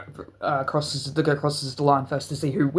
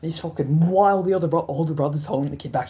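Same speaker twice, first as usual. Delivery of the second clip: ordinary delivery, nothing marked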